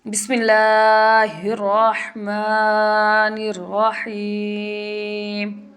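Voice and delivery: slow, drawn-out tajweed recitation; Quranic recitation. Shown as a demonstration. Teacher recites the verse aloud for students in tajweed. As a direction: recite it long, letting each madd stretch.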